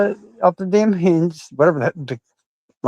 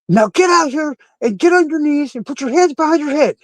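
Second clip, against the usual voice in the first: angry barked command; 12 semitones higher than usual. Retold or imitated. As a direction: imitate the angry cop yelling orders. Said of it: "Mister Angry Voice again goes".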